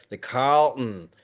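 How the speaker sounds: put-on "Carlton" drawn out slowly in an American accent; mock American pronunciation. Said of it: "we realized we had to say".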